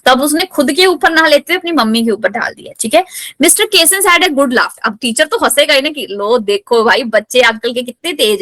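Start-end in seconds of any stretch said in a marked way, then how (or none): 3.4-4.8 reads the English line slowly, word by word; careful classroom read-aloud; slow text reading. Shown as a demonstration.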